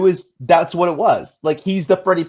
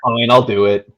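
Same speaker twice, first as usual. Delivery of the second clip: louder, lower reluctant sigh of a line; grudging; 4 semitones lower, 5 dB louder than usual. Retold or imitated. Co-host voicing the roped-in actor, grudging and louder. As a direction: say it like you got roped in. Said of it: "He's like".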